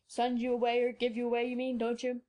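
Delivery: finishing her question kindly; old doctor. A narrator's reading. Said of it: "said the doctor, finishing the question for her".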